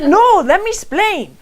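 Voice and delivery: high, eager retold protest; excited insistence. Woman retelling her plea to a friend, high excited insistence. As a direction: cut in, bursting to explain.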